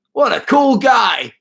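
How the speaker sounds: high, drawn-out dad impression; loud, high mock cheer in dad's voice; imitating his dad. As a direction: whoop it out slowly like an enthusiastic dad. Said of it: "He had said it in that voice as well".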